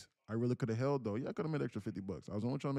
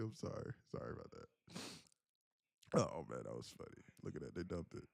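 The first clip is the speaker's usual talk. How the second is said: ordinary delivery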